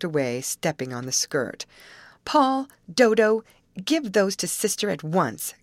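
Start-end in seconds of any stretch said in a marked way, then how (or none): 2.2-5.5 put-on loud, raised-pitch order to children; commanding. A narrator's reading. Molly to the twins holding her garments, commanding. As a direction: call out their names firmly, then give the order. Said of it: "commanded Molly".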